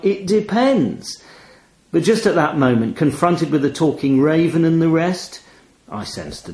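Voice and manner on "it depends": mock evasive. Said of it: "answers ... with phrases like".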